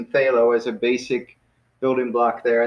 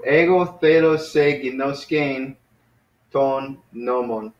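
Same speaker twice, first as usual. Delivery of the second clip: slow model sentence; slower than usual. Shown as a demonstration. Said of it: "models the full sentence".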